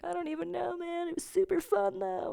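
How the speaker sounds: high-pitched schoolkid imitation; mock sheepish reply. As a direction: answer in a tiny high voice. Acts own reply to schoolkids.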